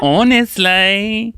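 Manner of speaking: high, very drawn-out Cockney; exaggerated Cockney; Cockney accent. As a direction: stretch it out in Cockney. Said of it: "do it in that accent".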